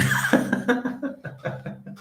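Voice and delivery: high, airy laugh at his own joke; high burst of laughter. Real, not acted. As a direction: crack up high at the punchline. Laughing at own border-agent joke.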